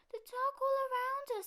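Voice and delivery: high small cub voice, scared; a tiny frightened cub; little bear cub. A narrator's reading.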